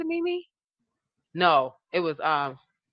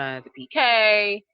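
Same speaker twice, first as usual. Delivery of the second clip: normal talking voice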